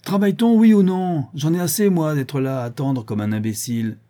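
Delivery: loud, high exasperated outburst, easing toward the end; impatient shout. A narrator's reading. Rueil, fed up waiting to work, shouts impatiently. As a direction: shout that you've had enough. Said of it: "Monsieur de Rueil cria".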